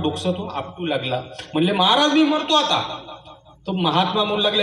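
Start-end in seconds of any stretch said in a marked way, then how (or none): 1.7-3.0 despairing cry. Acted. Brahmin threatening to die.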